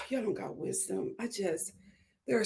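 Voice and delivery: sigh, then very soft and low; deflated exasperation. Real, not acted. Reviewer despairing at women lacking wisdom, hushed and deflated. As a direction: give up, almost under the breath. Gives up after the outburst.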